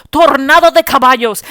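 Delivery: loud, high, dramatic Spanish; dramatic Spanish proclamation. Retold or imitated. Speaker showing mother how horrifying it sounds, loudly dramatic. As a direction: proclaim it like a disaster.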